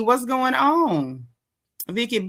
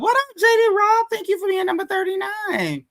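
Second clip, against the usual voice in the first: excited greeting; 10 semitones higher than usual. Real, not acted. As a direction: pitch jumps way up with joy. Excited shout-out to viewer.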